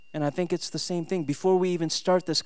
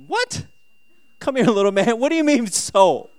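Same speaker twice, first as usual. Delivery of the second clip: high, loud mock-shocked dad; playfully scolding a small child, pitched up; indignant disbelief; 8 semitones higher, 8 dB louder than usual. Dad's shocked reply to son.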